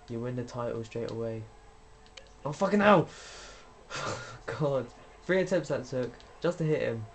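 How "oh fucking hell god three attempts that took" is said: furious outburst. Real, not acted. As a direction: explode at the game. Botched move in game.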